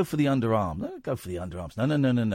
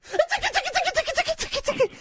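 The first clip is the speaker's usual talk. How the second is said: giggly tickle attack; much faster than usual. Pretend. Tickling the caller on air.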